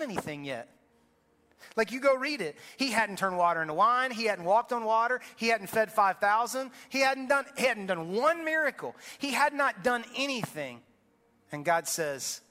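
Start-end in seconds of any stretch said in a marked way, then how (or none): 1.8-10.8 higher, louder pulpit build; rising revival preaching; full-force preaching. Real, not acted. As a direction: hammer each point home to the congregation.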